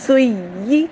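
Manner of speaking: سوئی with a firm doubled ی, stressed and rising. Shown as a demonstration. Teacher demonstrating the doubled ya of سوئی. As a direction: lean hard on the doubled ی in سوئی. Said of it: "دونوں یا پڑھنے ہیں".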